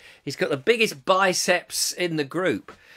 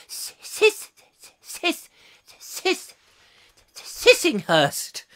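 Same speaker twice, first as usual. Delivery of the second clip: stuttering unvoiced sis before a slow, drawn-out Sissinghurst; stammer, then stretch out the place name; stuck on a word; 13 semitones higher, much slower than usual. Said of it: "she says".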